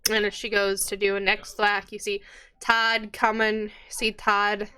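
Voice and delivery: ordinary talk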